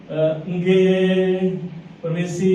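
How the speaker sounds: a gentle, bowing Javanese singsong; polite Javanese manner. Retold or imitated. Preacher mimicking a courteous Javanese speaker, lightly singsong. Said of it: "Tapi orang Jawa".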